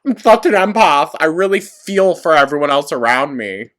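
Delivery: high, loud mock proclamation; hammed-up imitation of a self-proclaimed empath; mocking self-styled empath. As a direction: parody someone loudly boasting of their sensitivity. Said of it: "come on here and be like".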